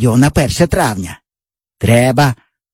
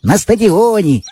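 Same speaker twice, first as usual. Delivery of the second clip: put-on higher eager boy voice; boyish friend; 6 semitones higher than usual. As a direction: brag like a cocky village boy. Java mocks Pavlusha.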